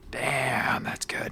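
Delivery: hushed, low admiration; awed whisper. Acted. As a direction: whisper it, impressed by the moment. Awed reaction to that moment.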